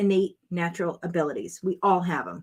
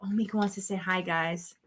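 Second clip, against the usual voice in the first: usual speaking voice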